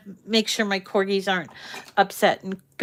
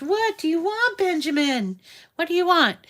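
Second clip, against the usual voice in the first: much higher, sing-song pet talk; talking to the dog; 8 semitones higher than usual. Real, not acted. Owner asking her corgi Benjamin what he wants, in high doggy talk.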